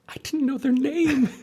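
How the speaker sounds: squeaky high, slow mock excuse; mock sheepish voice. Husband answering his wife's complaint, high squeaky mock voice. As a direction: play your past self as a small helpless squeak.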